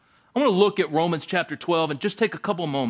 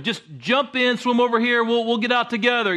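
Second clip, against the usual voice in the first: mild calling out; 6 semitones higher than usual. Acted. Neighbor yells from the raft.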